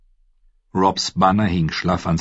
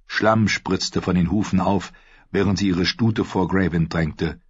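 usual speaking voice

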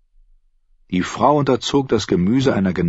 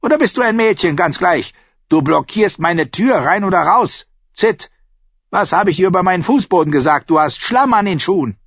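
high-pitched scolding innkeeper; irate landlady's tirade; snapping; 12 semitones higher, 4 dB louder than usual. A narrator's reading. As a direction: tear into them, high and sharp. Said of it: "fauchte sie".

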